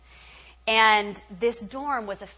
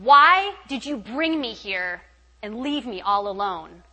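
slow, drawn-out accusation, louder; retold anger, raised voice; anguished cry to God; 8 dB louder, slower than usual. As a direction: demand an answer from God, stretching the words. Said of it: "I kinda got mad at God".

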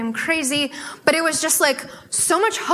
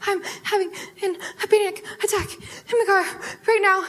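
tearful panic; 6 semitones higher than usual. Retold or imitated. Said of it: "looks up at me and she just goes".

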